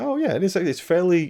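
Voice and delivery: high-pitched "oh yeah, it is" as it dawns on him; surprised realization. Retold or imitated. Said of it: "you look at the shot and you go".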